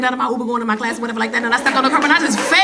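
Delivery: fast rattled-off retelling; fast-talking daughter. Retold or imitated. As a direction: rush through it like she did. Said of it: "And she talked fast".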